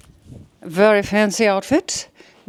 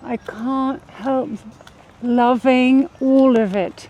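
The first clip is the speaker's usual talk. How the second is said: put-on British accent; 2 semitones higher than usual. Wife raving about the park to her husband, put-on British accent. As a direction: lay on a posh British accent, savouring the words. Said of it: "I am now British".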